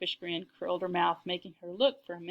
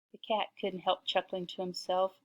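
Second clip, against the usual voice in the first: ordinary delivery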